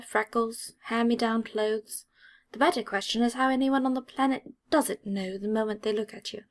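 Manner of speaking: ordinary delivery, nothing marked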